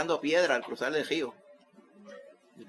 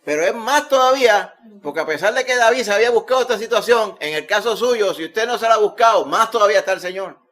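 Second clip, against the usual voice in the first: loud, raised preaching voice; preaching at full force; 8 semitones higher, 9 dB louder than usual. Acted. Preacher urges the congregation with loud, fervent preaching. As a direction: drive it home to the congregation.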